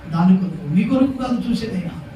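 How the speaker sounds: ordinary talk, nothing marked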